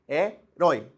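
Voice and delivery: Herói said loud with the stress on rói; stressed word demo. Shown as a demonstration. Teacher repeating Herói loudly to show the oxytone. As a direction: hit the last syllable of Herói. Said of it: "oxítona terminada em ditongo".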